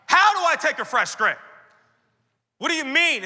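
loud, high outburst; exasperated protest. Acted. Pastor questioning the Lord's command, loud exasperated protest. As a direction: throw the question back, exasperated.

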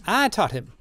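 high-pitched character answer; old man Drioli. A narrator's reading. Old man answering the crowd, high character voice. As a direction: lift the voice far above narration.

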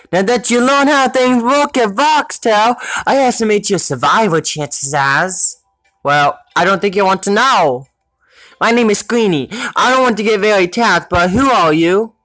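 robot companion voice talking to the player; robot sidekick voice. Acted. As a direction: voice the little robot Squeenie, high and dry.

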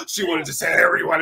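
ordinary delivery

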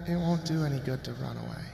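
put-on soft, fast, eerie child's voice; soft fast creepy child; seeping child voice. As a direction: taunt him from inside the wardrobe. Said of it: "a child's voice seeped".

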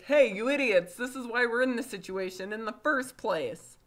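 loud, high-pitched telling-off; hammed-up exasperated parent; mock angry scolding. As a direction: berate them in an acted outburst. Acted parent scolding the children.

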